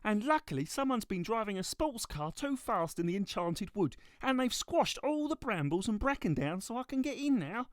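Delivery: a little one explaining eagerly; high little trolley. Acted. Shopping trolley voiced.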